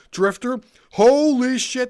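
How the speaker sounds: high, loud exclamation; mock viewer disbelief. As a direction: gasp it out like a stunned viewer. Imagined viewer reacting.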